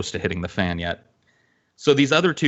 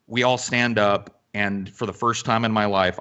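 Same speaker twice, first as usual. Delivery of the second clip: plain reading voice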